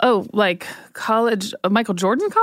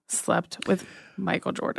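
whispering; 2 semitones lower, 9 dB quieter than usual. Real, not acted. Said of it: "Why are we whispering?".

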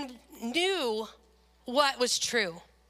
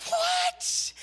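very high, squeaky shocked what; high-pitched stunned what. Retold or imitated. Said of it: "I'm like".

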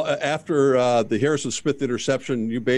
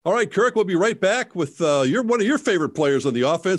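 booming prize announcer; 6 semitones higher than usual. Acted. Host announces segment break.

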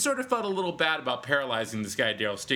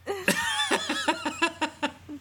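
burst of laughter. Real, not acted. Laughing at own tangent.

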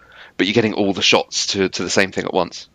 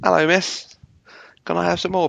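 put-on British accent; 8 semitones higher than usual. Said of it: "my British accent in the background".

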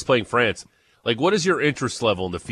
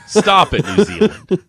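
mock scolding; 10 semitones higher, 7 dB louder, slower than usual. Mock scolding New Zealand.

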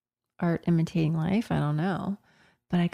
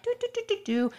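high, light "do-do-do" patter; high-pitched walking-along ditty; sing-song strolling noise; 13 semitones higher, 3 dB quieter, faster than usual. Retold or imitated. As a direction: imitate a carefree stroll in little high notes. Imitates people strolling down beach.

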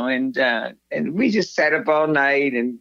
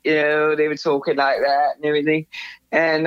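ordinary delivery

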